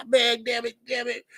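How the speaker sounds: normal talking voice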